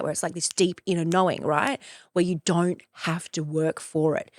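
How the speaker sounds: ordinary talk, nothing marked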